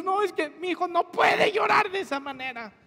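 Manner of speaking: hammed-up worried outcry; anxious protest. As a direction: parent insisting their child can't cry like that. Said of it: "Y nosotros".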